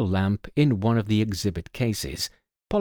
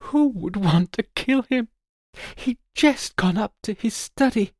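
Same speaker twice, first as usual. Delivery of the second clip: distraught; 11 semitones higher than usual. Acted. Grieving daughter continues.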